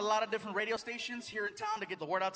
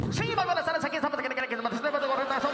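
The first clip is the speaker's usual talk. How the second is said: much higher, loud, fast Mexican radio announcer; loud Spanish radio host; 7 semitones higher, 7 dB louder, much faster than usual. Retold or imitated. Spanish radio host on air, loud, high and fast. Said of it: "he's like".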